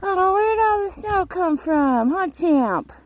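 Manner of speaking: high, slow silly horse voice; voicing the horse. Voicing her horse's thoughts in a high slow voice. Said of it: "but he's like".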